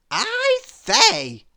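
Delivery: very high, loud silly voice; Mister Silly voice. A narrator's reading. Narrator voicing Mister Silly at the window. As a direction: chirp it up high in delight. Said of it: "he said".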